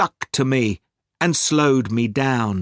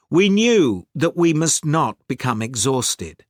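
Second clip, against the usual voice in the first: usual speaking voice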